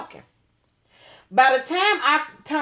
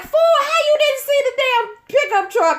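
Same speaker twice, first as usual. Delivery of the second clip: high, louder mock scolding; exasperated scolding; 4 dB louder than usual. Scolding the driving friend after the crash, high exasperated. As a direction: chew the driver out in disbelief.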